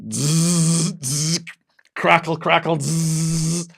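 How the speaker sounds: high buzzing zaps and crackles, voiced; buzzy crackling imitation of electric shocks; imitated electric buzz. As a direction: mimic a cattle prod zapping. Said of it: "Then I hear this noise".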